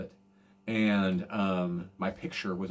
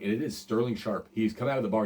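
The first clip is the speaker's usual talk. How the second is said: usual speaking voice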